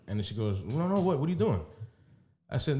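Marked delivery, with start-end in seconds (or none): 0.6-1.6 higher-pitched mock alarm; quick retold objection, pitched up; imitated protest. Man imitating woman stopping his goodnight, protesting.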